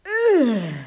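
loud "ugh" falling from high; disgusted groan. A narrator's reading. Visitor sniffing the air at the house, loud disgusted groan.

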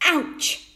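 high, loud yelp on landing; pained yelp. A narrator's reading. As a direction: yelp as if bumped hard. Tom lands in the grass.

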